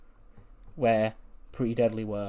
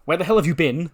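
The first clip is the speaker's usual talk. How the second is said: raised, higher mock scolding; exasperated demand; 5 semitones higher, 7 dB louder than usual. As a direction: demand an explanation from someone long missing. Retold scolding of returning friend.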